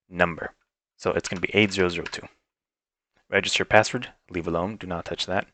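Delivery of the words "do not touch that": normal talking voice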